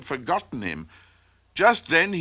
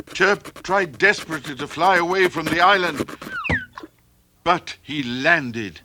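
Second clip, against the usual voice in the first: usual speaking voice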